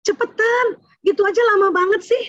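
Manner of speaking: high impatient scolding; bossy command. Retold or imitated. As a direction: order the child impatiently.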